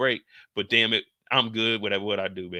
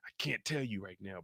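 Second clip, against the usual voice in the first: secretive whisper; 3 semitones lower, 12 dB quieter than usual. Acted. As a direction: whisper it, much softer. [name] hushes secret answer.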